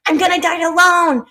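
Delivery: indignant, hammed-up complaining; whining Karen. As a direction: imitate a Karen ranting at the universe. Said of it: "the more you're being a Karen".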